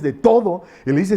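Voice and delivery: ordinary delivery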